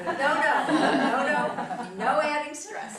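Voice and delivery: loud laughing no. Real, not acted. Laughs at own joke.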